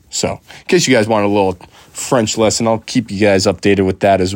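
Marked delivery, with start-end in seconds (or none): none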